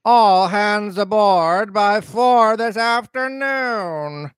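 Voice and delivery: high, long-drawn call after someone. A narrator's reading. Squire calling after the departing cook, high drawn-out shout. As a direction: holler it slowly on a high held pitch, without full volume. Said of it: "shouted the squire after him".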